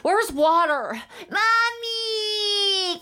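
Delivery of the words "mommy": high, drawn-out wail for mommy; toddler cry. Acted. Toddler crying out to mom from across the room. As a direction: wail like a little kid.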